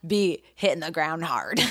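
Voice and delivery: usual speaking voice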